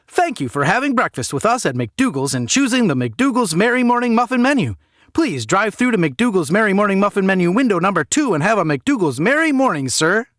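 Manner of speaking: fast-food cashier greeting a customer, forced cheer; chirpy cashier. Acted. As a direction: sell the muffin menu like a trained robot. Cashier's scripted farewell.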